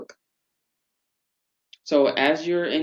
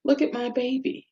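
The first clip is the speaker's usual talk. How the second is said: amazed parent; 10 semitones higher than usual. Retold or imitated. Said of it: "the parents just go".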